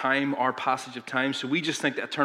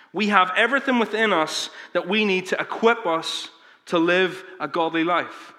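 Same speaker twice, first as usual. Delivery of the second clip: raised, then slowed on the last words; preaching climax; 5 semitones higher, 4 dB louder than usual. Acted. Sermon climax, slowing.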